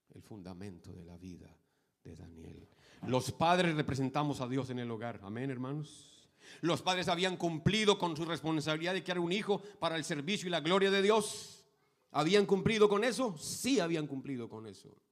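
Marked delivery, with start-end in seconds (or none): 3.0-15.0 loud, high, emphatic sermon; full-force preaching. Acted. Pastor preaching loudly to the brothers and sisters. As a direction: drive the lesson home.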